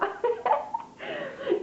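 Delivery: a burst of high-pitched giggling; little embarrassed laugh; high giggle. Real, not acted. Laughing at her own joke.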